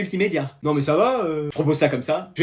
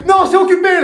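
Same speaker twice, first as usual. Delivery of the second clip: high-pitched protest; calling out refusal; 10 semitones higher than usual. Acted. Teacher to waiting colleague from the toilets, calling out a refusal. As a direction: call it out in annoyance.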